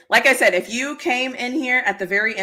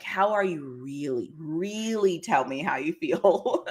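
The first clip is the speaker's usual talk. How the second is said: slower, lower and softer, gently probing; sincere follow-up; 5 semitones lower, 5 dB quieter, slower than usual. Retold or imitated. Friend gently pressing a friend to open up, slow and soft. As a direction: ask it slowly and softly, meaning it.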